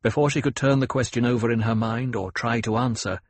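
usual speaking voice

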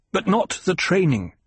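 high-pitched, insistent rebuttal; bitter protest. A narrator's reading. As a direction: cut back with reproach. Son bitterly rejects mother's answer.

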